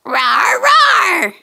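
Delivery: hammed-up kid-show roar; playful dinosaur roar. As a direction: give the toy dino a big roar. Toy dinosaur roars.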